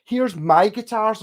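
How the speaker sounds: mock a boastful guy; mocking braggart. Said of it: "some guy going".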